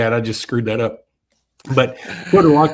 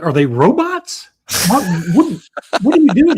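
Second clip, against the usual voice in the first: higher and louder, baffled disbelief; incredulous dad; 8 semitones higher, 5 dB louder than usual. Retold or imitated. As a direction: act stunned by the weird name. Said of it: "And I was like".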